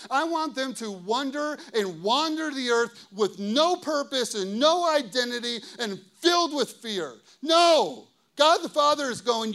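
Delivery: loud, driving sermon delivery; impassioned preaching. Real, not acted. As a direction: build insistently, hammering each phrase home. Preaches at full force.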